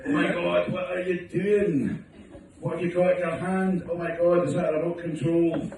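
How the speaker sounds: mock gasp of a shocked wife. Wife catching him, acted quote.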